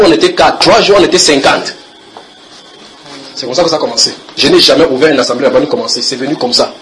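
loud, fervent preacher's testimony; loud sermon voice, testimony told with conviction; preaching at full force. Acted. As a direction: proclaim it to the whole church. Preaching to the congregation.

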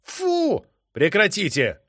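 high, loud disgusted bark. A narrator's reading. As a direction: snap them to silence. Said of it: "рявкнул я".

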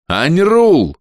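громкий высокий окрик; позови, перекрикивая шум; крик. A narrator's reading. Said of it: "закричал уже я". (громко окликнуть бога)

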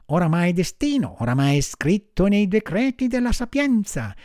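high-pitched marmot lecturing on fate; high, preachy little animal; consoling marmot. A narrator's reading. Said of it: "replicò la marmottina per consolarlo".

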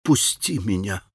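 soft, hushed plea; a wife begging to be let go, hushed; whispered plea. A narrator's reading. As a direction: let it out barely above a breath. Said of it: "прошептала она".

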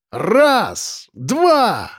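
rising, high-pitched heave-ho; commanding count. A narrator's reading. Man leading three people pulling a chain, commanding count. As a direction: count them in, climbing in pitch with effort. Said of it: "скомандовал я".

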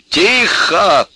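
loud, high shout for silence; barked command. A narrator's reading. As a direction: bark it to shut them up. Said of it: "рявкнул добрый Максимыч".

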